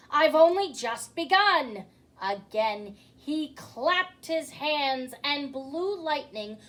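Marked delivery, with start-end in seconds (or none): 0.1-1.9 loud and higher, gloating; roaring. A narrator's reading. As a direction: roar it with glee. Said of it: "roared the magician".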